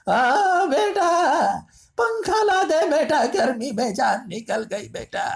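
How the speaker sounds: high, slow, pleading elder calling her son; old parent calling. Acted. Exhausted elder begs son for a fan in the heat, high pleading call.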